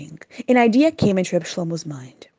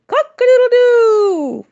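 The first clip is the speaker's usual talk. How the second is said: loud, high, slow cock-a-doodle-doo; rooster crow; 8 dB louder, slower than usual. Retold or imitated. Reb Shlomo showing the guests the rooster's loud crow.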